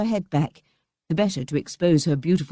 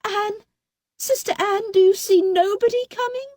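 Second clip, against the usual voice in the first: desperate crying out. A narrator's reading. Fatima crying up to her sister. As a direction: beg for any sign. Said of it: "she called out".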